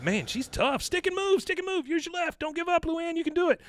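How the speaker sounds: very high, coaching shout; fan cheering. Retold or imitated. Fan in the crowd urging Luann on, high excited shouts. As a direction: yell corner advice from the stands.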